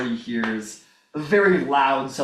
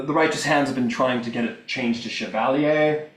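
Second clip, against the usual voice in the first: ordinary talk, nothing marked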